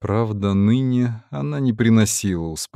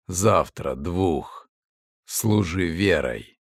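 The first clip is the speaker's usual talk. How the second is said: whispery telepathic voice; 3 semitones lower, slower than usual. Acted. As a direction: stretch out each word, low and cold. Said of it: "сказала маска".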